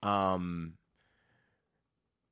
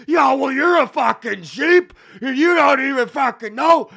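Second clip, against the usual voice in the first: mock yelling back; 12 semitones higher, 12 dB louder than usual. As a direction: yell the insult back, hammed up. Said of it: "the guy goes".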